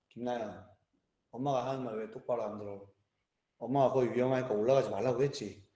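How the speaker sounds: low, quiet firm scolding; stern low dad voice. Retold or imitated. Father firmly telling his toddler daughter off, low and stern. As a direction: calmly lay down the rule. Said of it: "내가 뭐라고 했냐면".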